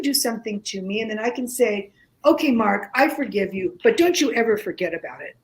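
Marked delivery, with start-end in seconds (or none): none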